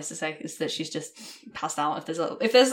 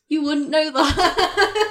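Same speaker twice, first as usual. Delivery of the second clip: higher, louder and very slow, teasing DM ruling; mock DM line; 8 semitones higher, 5 dB louder, much slower than usual. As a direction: drag it out like a smug game master. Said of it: "My favourite D M phrase is".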